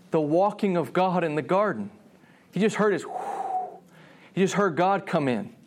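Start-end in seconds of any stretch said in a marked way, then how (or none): 3.1-3.8 soft, breathy "whoo"; imitated whoosh. Adam just hearing God come in, imitated whoosh. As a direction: blow out a quiet whoosh.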